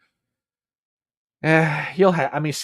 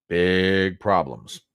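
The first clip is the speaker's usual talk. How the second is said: very slow, weighty delivery; slow dramatic emphasis; slow ominous; 3 semitones lower, much slower than usual. Real, not acted. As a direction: drag out the warning.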